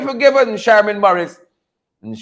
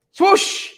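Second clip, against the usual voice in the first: airy high unvoiced swoosh; whooshing swoosh; 9 semitones higher, 3 dB quieter than usual. Host mimics leaders swept away, airy whoosh. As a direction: breathe it out like a quick whoosh. Sound effect word.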